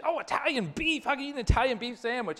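high, fast disbelieving retort; incredulous scoff. Retold or imitated. Pastor scoffing at the Italian beef suggestion, high and quick. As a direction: toss it off like the idea is absurd.

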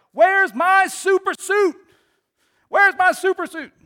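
high, loud, slow desperate shout; frantic yell. Retold or imitated. Frozone shouting to his wife for his suit, high and loud. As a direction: bellow for the missing suit in a panic. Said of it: "he screams at the top of his voice".

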